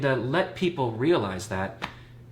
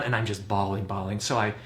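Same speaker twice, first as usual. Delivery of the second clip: ordinary delivery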